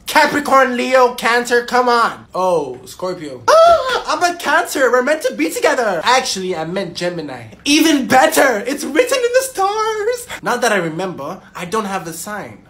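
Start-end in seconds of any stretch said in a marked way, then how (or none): 3.5-6.0 breathless, thrilled and high; gasp, then gush about fate; excited gasp. Acted. 8.7-10.4 high, slow and dreamy. Acted. Dreamy flirting line.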